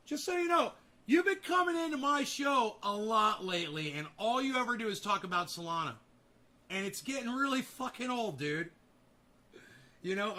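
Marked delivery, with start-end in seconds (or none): 0.1-8.7 much higher and louder, fed up; telling off the commenter, heated; angry outburst. Real, not acted. Host snaps at a repeat commenter.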